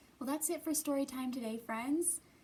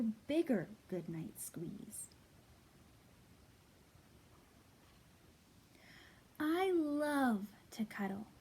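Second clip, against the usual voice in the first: put-on lively read-aloud to children; storybook reading. A narrator's reading. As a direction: read it like story time for little kids. Reads a picture book aloud.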